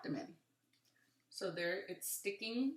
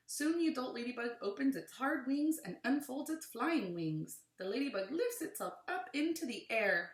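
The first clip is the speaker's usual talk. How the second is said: animated read-aloud with rising and falling emphasis; clear, lilting picture-book narration; reading to children; 4 semitones higher, 5 dB louder than usual. Acted. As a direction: read it to a class, punching key words.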